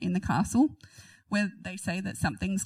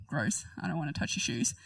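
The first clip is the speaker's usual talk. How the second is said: disgusted aside; 6 semitones lower, 5 dB quieter than usual. Real, not acted. Disgust at sandal custom.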